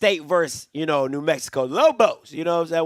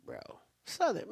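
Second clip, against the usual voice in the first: ordinary talk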